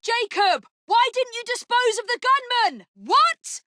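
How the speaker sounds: high, urgent yell; alarmed shout. Acted. Sister yells at Jacob about the gunman, alarmed.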